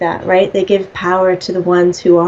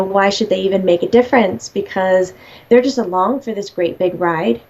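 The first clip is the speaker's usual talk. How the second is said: usual speaking voice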